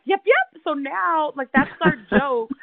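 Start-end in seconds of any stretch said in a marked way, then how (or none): 0.0-0.6 high, beaming sales voice; overly smiley agent. Retold or imitated. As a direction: gush like a too-cheery agent. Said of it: "she was like".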